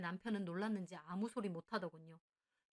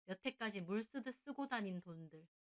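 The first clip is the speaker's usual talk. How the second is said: plain reading voice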